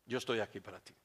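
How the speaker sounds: soft, low comforting voice; tender reassurance. Acted. God as father comforting His child, tenderly. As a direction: drop down gently to console.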